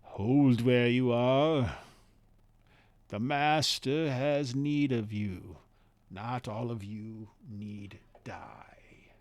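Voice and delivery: slow, flat, toneless monotone; cold monotone command, loud then whispered. Acted. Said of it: "kinda speaking in a monotone voice".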